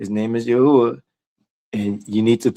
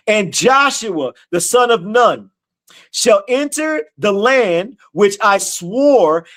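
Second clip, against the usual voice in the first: slow, high, ringing scripture reading; preaching scripture; 7 semitones higher, much slower than usual. Acted. Preacher reading God's words to the congregation, slow and ringing. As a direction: proclaim each phrase slowly as the voice of God.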